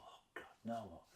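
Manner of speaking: hushed, whispered groan of dread; soft, whispered exasperation; exasperated inner thought. Retold or imitated. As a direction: whisper it under your breath, exasperated.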